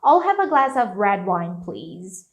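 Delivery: agile linked flow through "have a glass of"; connected speech. Shown as a demonstration. Teacher proving connections give agility, linked "have a glass of". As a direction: link "have a glass of red wine" without rushing. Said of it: "não tô botando intenção de falar rapidão".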